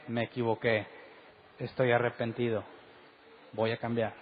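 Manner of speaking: low, soft acted admission of fault; humble confession. Preacher models owning a mistake to the congregation, humble confession. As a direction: own up quietly, with repentance.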